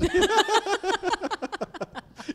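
high burst of laughter; loud laugh. Real, not acted. Pregnant joke, big laugh.

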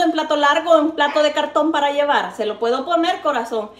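ordinary talk, nothing marked

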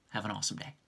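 soft whispered sign-off; whispering. Real, not acted. Baby sleeping, signing off softly.

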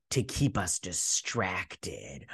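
lightly voiced, slow, low and soft; ominous reveal. Heroes revealing the villain's plan, low and slow. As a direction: drop low and slow for the sinister twist.

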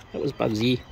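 normal talking voice

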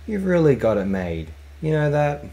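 a sneering loud taunt. Acted. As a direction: raise the voice at the pilot with contempt. Contemptuous captain scolds pilot.

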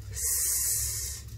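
S as a long unvoiced hiss, sssss; S said as a long hissing sound. Shown as a demonstration. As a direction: hiss the S like a snake. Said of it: "S sound".

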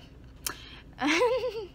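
high, falling burst of laughter. Real, not acted. Laughing off her ruined Sunday, high and falling. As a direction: let out a laugh at your own bad day.

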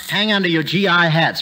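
ordinary talk, nothing marked

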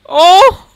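a sudden loud high yelp of dismay; shocked shriek. Real, not acted. Shrieking at the ruined Sims face. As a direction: shriek at the screen in horror. Seeing the ugly nose result.

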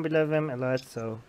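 normal talking voice

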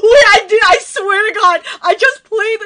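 high, very loud insisting; flustered protest. Real, not acted. Streamer swearing innocence about the lyrics, loud flustered protest. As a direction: protest loudly, still worked up.

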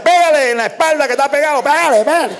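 put-on high, urgent old folks' voice; grandparents urging. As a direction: urge them to slap the kid's back. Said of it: "Que los abuelos decían".

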